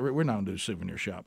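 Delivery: quick, low, softened quote; retold wife's refusal. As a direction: play the unimpressed wife, quietly and fast. Retells wife refusing the souvenir shop.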